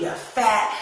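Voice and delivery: normal talking voice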